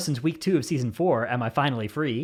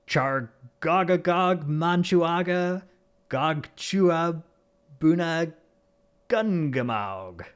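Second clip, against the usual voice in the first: slow, drawn-out place name; slow careful pronunciation; much slower than usual. Shown as a demonstration. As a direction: sound out each syllable slowly. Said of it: "I would get offered the opportunity to pronounce".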